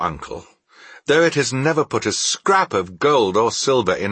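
animated heartfelt speech, building at the end; earnest cheerful nephew. Acted. Nephew defending Christmas to his uncle, earnest and animated. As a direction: speak up for what you love, lifting to a high finish.

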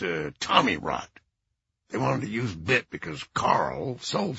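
put-on low, stuffed-up old man; low congested voice. Lanny's voice continues.

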